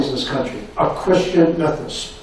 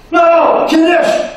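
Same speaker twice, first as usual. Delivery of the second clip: loud, high "No, Kiddush!" cutting him off; insistent shout; 14 semitones higher, 6 dB louder, much slower than usual. Retold or imitated. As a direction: overrule him firmly and loudly.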